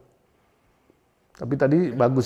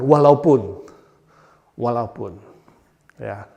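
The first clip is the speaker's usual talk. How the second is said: ordinary delivery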